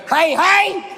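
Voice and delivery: put-on high, chirpy greeting as if to children; kids' party entertainer. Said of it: "treat it like a kid's birthday party".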